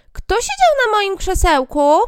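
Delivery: high, loud little dwarf asking. Acted. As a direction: squeak it out like a tiny puzzled dwarf. First dwarf asks, voiced high. (wysoki głos krasnoludka)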